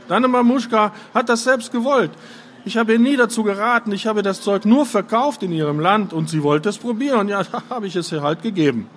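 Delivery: high-pitched, quick self-justification; defensive excuse. A narrator's reading. Father excuses himself to angry son. (sich rechtfertigend, mit hoher Stimme)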